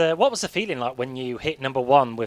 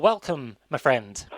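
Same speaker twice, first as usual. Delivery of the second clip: normal talking voice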